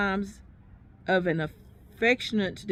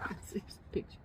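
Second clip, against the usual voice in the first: whispered aside; 13 dB quieter than usual. Real, not acted.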